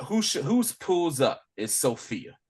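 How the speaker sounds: ordinary delivery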